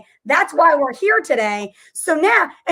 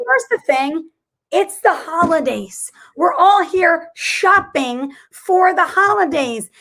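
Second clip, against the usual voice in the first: indignant rising plea; 4 semitones higher than usual. Retold or imitated. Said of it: "'Cause I'm like".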